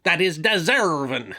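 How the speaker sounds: slow growling pirate. Acted. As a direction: drag it out like a salty buccaneer. Back into pirate character.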